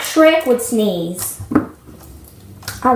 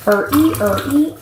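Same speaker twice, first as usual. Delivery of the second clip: ordinary talk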